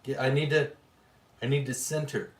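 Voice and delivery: ordinary delivery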